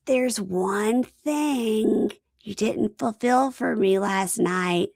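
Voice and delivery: slow, breathy come-on; mock seductive drawl; sultry wife voice. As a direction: purr it out slowly like a tease. Seductive wife in bed recap.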